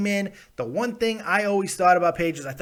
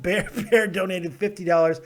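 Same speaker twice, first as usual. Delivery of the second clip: normal talking voice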